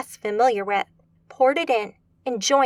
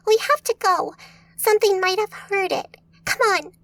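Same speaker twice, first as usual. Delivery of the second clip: much higher, urgent little companion; 8 semitones higher than usual. A narrator's reading. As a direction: hurry her along, high and pressing. Bramble urges flight.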